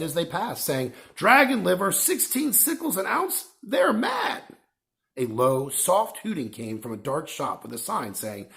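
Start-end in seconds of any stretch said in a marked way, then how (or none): 1.1-4.4 high, outraged plump woman; indignant shopper. Acted. Plump woman scolding the prices, high and indignant. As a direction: huff at the price, much higher. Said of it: "shaking her head".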